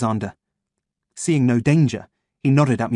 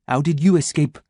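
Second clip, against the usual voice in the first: cold question; 4 semitones higher than usual. Acted. Chantal asks, other voice.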